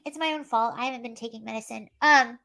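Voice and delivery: normal talking voice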